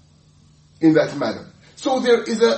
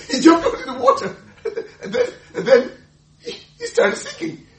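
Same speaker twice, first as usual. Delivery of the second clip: laughing; 14 semitones higher, 4 dB louder than usual. Real, not acted. Preacher laughing retelling Peter.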